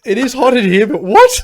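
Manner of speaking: loud, high joking yell; loud mock confusion. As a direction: shout back into the bit. Joins the Aussie bit, shouting.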